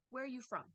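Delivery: WH-question falling to a close on FROM; falling question intonation. Shown as a demonstration. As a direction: start "Where" high and drop through "from". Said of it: "it can be a falling intonation".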